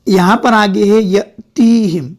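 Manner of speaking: normal talking voice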